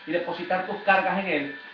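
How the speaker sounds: soft, low comforting appeal; soft pastoral comfort; tender invitation. Real, not acted.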